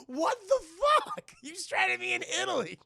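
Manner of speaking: high, loud, disbelieving protest; mock outraged cry. Woman stranded by the roadside, high outraged cry. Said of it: "she's like".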